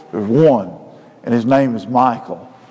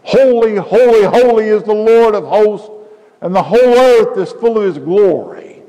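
high, loud, ringing proclamation; angelic cry of praise; 7 semitones higher, 4 dB louder than usual. Acted. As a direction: cry it out like a seraph before the throne. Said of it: "one cried unto another and said".

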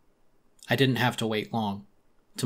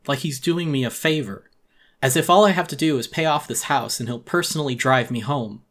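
ordinary delivery

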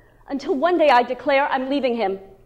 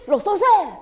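impatient; 4 semitones higher than usual. Retold or imitated.

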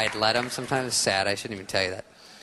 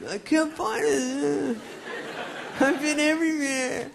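very high, slow whine; whiny teen; slower than usual. Retold or imitated. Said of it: "And I was like".

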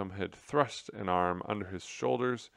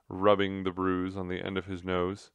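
ordinary delivery

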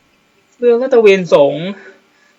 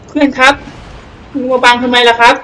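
higher, louder, exaggeratedly polite; mock polite complaint; 4 semitones higher, 5 dB louder than usual. Real, not acted. Gamer calling to a teammate blocking his shot, mock-polite loud complaint. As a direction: scold a teammate with fake courtesy.

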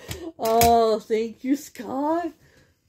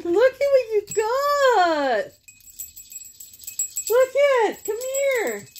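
pet talk; 12 semitones higher than usual. Real, not acted. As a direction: coo at the dog. Talking to her dog Zen.

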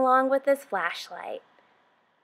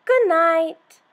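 higher, louder playful animal voice; lightly voiced hyena; hyena saying good night; 7 semitones higher, 6 dB louder than usual. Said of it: "The hyena".

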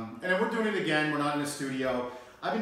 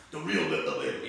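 Giovanni Palo impression; 9 semitones lower than usual. Host echoing Giovanni about the Bronx, lower mild imitation. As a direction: lightly imitate Giovanni's low proud tone. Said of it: "as G-, Giovanni says".

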